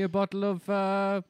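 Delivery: put-on rising South African accent. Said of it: "I was doing the accent".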